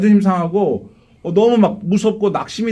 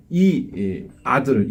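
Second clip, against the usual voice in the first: normal talking voice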